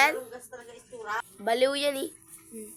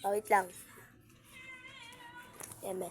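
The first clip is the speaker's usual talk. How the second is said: ordinary delivery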